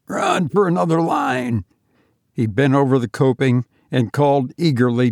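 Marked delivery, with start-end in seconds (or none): 0.1-1.6 higher, urgent call; urgent cry. Acted. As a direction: cry out for help. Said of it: "cried George".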